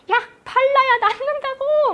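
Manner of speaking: put-on a fed-up parent yells at the child; yelling parent. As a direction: parent loses patience and shouts. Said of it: "막, 막 소리 질러요".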